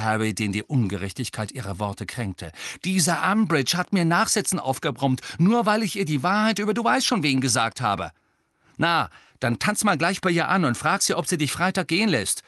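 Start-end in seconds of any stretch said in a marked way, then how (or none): 2.8-8.1 high and loud, stung; indignant protest. A narrator's reading. Harry protests to Angelina, indignant. 8.8-12.4 high, loud, scornful; angry sarcasm. A narrator's reading. Angelina tells Harry off, angry and sarcastic. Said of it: "sagte Angelina wütend".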